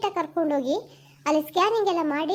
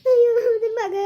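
loud wailing cry, pitched up; 5 semitones higher, 6 dB louder than usual. Acted. Mother wailing to the doctor over her sick son, loud crying cry. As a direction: wail for your child in despair. Mother hears child is critical.

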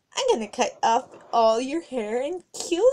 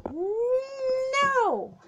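squeaky refusal; 13 semitones higher than usual. Acted. Refusing to be seen bald.

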